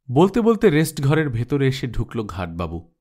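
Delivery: plain reading voice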